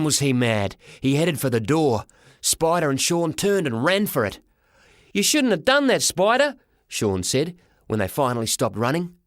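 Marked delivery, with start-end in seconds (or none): 5.1-6.6 put-on high-pitched kid's reproach; high, worked-up young lad; scolding boy. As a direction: chide a friend in a high voice. Boy scolds his friend.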